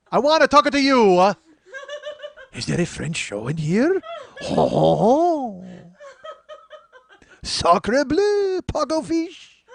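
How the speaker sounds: loud, high cartoon Frenchman; over-the-top French accent. French guy poking into the show, hammed-up French accent. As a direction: ham up a booming French accent. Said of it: "I had to tell you it was a French guy".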